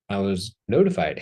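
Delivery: ordinary talk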